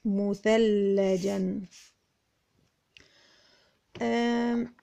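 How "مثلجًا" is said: مثلجا drawn out slowly, ending "-jan"; joined tanween. Shown as a demonstration. Teacher showing tanween joined to jeem, slow sounding-out. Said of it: "لازم التنوين يكون متصل مع الجيم".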